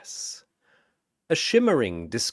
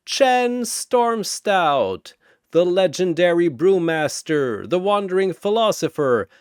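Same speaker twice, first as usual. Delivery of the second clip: booming announcer; 6 semitones higher, 3 dB louder than usual. Acted. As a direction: boom it across the arena. Announcer introduces next fighter.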